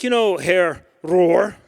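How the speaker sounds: slow, drawn-out Herr Rohr; slow careful address. Retold or imitated. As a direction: ease into the question carefully. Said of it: "I was like".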